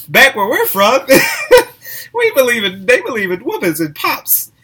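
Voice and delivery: loud, high laughing talk. Real, not acted. Speaker recalling parents' strict discipline, laughing out loud.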